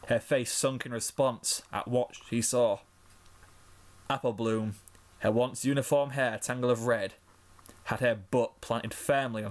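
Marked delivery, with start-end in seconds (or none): none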